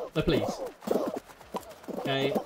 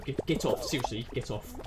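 ordinary delivery

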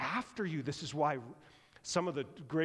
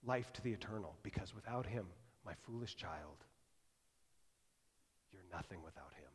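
tender hush; 6 semitones lower, 8 dB quieter than usual. Retold or imitated. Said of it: "Jesus says".

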